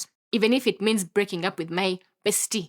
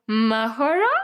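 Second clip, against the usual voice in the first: louder, long-held Mahoro with a lilting rise; lovey-dovey; 3 semitones higher, 4 dB louder, slower than usual. Shown as a demonstration. Her dream of crush saying Mahoro, lovey-dovey. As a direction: stretch MAHORO out romantically, rising at the end. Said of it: "in a very lovey-dovey way".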